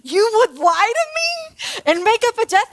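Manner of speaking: high-pitched, incredulous question; shocked disbelief. Retold or imitated. Teacher reacting to a student's made-up death, shocked. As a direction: ask it shook, pitch climbing high. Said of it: "I'm just shook".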